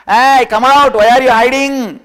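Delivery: loud high shout; calling out. Acted. Caller yells at the man behind the rock, loud. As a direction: shout him out of hiding.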